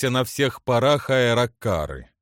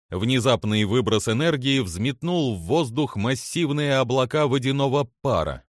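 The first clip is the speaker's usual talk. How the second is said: ordinary delivery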